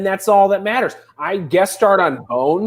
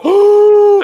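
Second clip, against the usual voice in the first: gasping oh; 9 dB louder than usual. Real, not acted. Excited gasp at realization.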